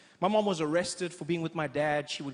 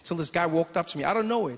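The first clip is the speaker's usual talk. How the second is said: ordinary delivery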